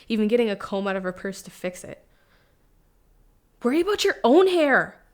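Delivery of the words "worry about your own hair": loud, high-pitched retort; snapping back. Acted. Daughter to mom criticizing her hair, snapping back. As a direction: throw it back at her sharply.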